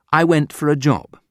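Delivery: ordinary delivery, nothing marked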